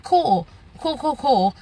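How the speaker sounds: dry mock-cheerful cool cool; fake-fine, sarcastic repeat. Patient mocking the doctor's late news, dry sarcasm.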